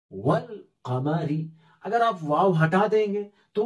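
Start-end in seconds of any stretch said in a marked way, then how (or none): 0.1-1.5 वल कमरी read slowly, the वल joined straight onto कमरी; slow and deliberate, वल कमरी with the alif silent; slow Quranic reading. Shown as a demonstration. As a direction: recite वल कमरी slowly and carefully, with no alif sounded. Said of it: "वाव लाम जबर वल".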